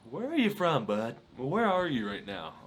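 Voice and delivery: loud, high-pitched retort in retelling; exasperated. Retold or imitated. Acted exasperation at rattled stranger.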